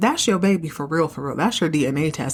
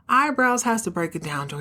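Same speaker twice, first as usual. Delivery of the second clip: ordinary delivery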